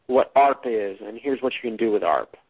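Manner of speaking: ordinary talk, nothing marked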